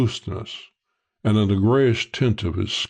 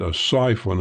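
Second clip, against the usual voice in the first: ordinary delivery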